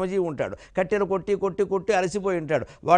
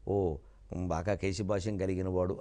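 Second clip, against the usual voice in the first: low, quiet murmur of mock understanding; musing acceptance; 11 semitones lower, 8 dB quieter than usual. Devotee working out a holy name's meaning, musing acceptance. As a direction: murmur it low, as if privately satisfied.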